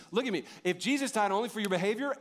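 ordinary talk, nothing marked

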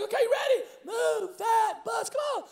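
high-pitched hyped host call, stretched words; Ty Penny, rallying. Retold or imitated. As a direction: draw out the command like an excited host. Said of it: "He's like".

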